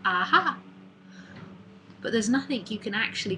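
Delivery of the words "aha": sudden aha. Retold or imitated. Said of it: "and it's like".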